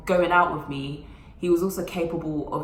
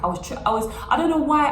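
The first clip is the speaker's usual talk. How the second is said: normal talking voice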